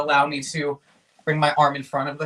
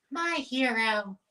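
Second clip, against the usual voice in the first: slow, breathy swoon, higher; swooning damsel; 7 semitones higher, 5 dB quieter, much slower than usual. Acted. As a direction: sigh it like a rescued heroine. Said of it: "the classic".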